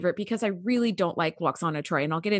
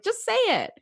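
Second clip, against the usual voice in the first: usual speaking voice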